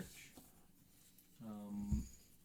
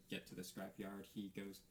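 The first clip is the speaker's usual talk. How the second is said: ordinary talk, nothing marked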